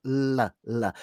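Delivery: "L, l" with tongue tip behind the top teeth; front L. Shown as a demonstration. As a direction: demonstrate a forward L. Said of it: "should be in the same position as for the T".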